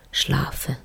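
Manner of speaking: sleepy whisper, drifting off. Pretend. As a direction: let it fade into sleep. Deep sleep.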